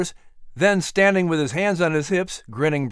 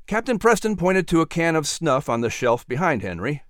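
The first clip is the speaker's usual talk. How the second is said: plain reading voice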